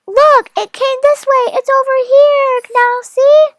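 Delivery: high, loud excited yell; loud high-pitched calling out; wild boar screaming. Acted. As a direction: shout like a boar who spotted its prey. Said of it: "He heard a wild boar scream".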